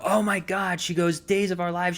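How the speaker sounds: retelling the waitress's excited aha moment; excited recognition. Retold or imitated. Waitress suddenly recognizes him.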